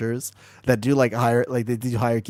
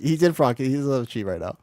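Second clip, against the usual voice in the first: normal talking voice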